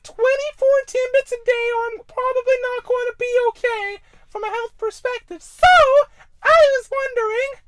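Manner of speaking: very high squeaky fan voice; Gabriel Iglesias impression. Caller in a squeaky voice asking Ben for advice. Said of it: "talk like this all the time".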